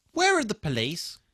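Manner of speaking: ordinary talk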